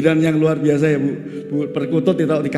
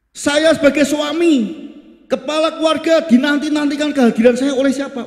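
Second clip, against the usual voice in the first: raised, passionate sermon declaration; fervent preaching; 8 semitones higher than usual. Acted. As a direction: proclaim it to the whole church. Preacher at full force on family.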